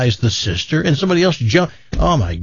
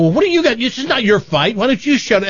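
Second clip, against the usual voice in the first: higher-pitched, mildly indignant retort; mock quarrel; 9 semitones higher, faster than usual. Host acts telling off meddling cousin, mock indignation. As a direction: act out snapping back in a family row. Acted family quarrel, snapping back.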